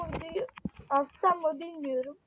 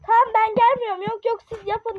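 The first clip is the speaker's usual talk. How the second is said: loud, higher-pitched refusal; annoyed raised voice; huffy; 4 semitones higher, 5 dB louder than usual. Real, not acted. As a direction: refuse in a huff. Huffily refusing to come along.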